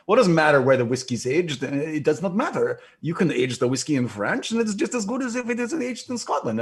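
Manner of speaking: put-on a lightly French-accented English, ending high; imitating the maker's French-accented English. Quoting the French whiskey maker in a French accent. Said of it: "this is his English accent".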